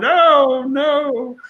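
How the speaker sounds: high, hammed-up woe-is-me wail; mock upset. Coach mimicking someone who won't solve problems, mock upset. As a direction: play the self-pitying victim. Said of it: "instead of just getting upset about everything".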